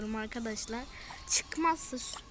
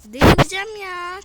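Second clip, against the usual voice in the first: a much louder, higher cry of frustration; loud exasperation; 4 semitones higher, 14 dB louder than usual. Real, not acted. Gamer watching his team lose, loud frustrated cry. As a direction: shout it out at the end of your rope.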